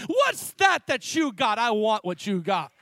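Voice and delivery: loud exclamation, drawn out at the start; retold amazed demand. Coworker demands to know a believer's secret, loud retold amazement. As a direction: burst out loudly, wanting the same thing.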